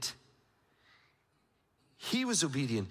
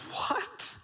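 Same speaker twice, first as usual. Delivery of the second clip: stunned what. Acted. Amazed at his own point.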